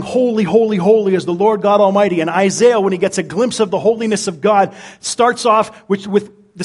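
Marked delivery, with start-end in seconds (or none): 0.0-2.2 raised, ringing proclamation of holy; louder exalted cry of holy holy holy. Retold or imitated. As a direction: call it out like the seraphs before the throne. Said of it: "they're crying".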